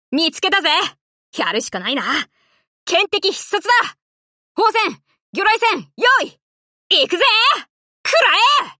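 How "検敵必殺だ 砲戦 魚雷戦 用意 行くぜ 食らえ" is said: fierce combat yell; battle shout. Acted. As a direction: yell the orders over gunfire. Combat attack lines.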